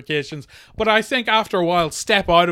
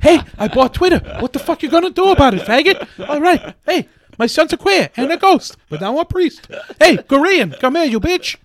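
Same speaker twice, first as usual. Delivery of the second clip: high, brash tough-guy Boston voice; Mark Wahlberg impression; 9 semitones higher, faster than usual. Mocking Mark Wahlberg to his co-host, high brash tough guy. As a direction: swagger it out like a cocky Boston tough. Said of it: "That's, that's Mark Wahlberg".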